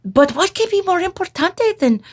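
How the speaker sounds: put-on high Spanish-accented kitten question; Skipito. A narrator's reading. Kitten asking his muchachos, high accented voice. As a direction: ask it high and puzzled, Spanish-flavored. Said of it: "asked Skipito".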